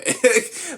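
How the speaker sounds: high burst of laughter; big real laugh; loud laugh. Real, not acted. Laughs at own bit.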